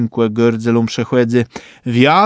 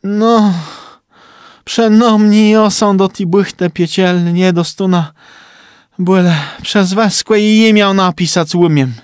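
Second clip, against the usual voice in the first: sighing; 6 semitones higher than usual. A narrator's reading. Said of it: "tej rzekł wzdychający".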